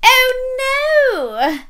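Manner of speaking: high-pitched cry of dismay; high, alarmed cartoon voice; melons' dismay. A narrator's reading. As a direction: squeal it as panicked storybook melons. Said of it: "mouthed the melons".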